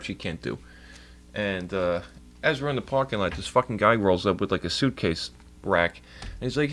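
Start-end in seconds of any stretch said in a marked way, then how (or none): none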